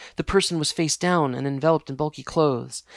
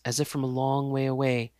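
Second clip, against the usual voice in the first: ordinary delivery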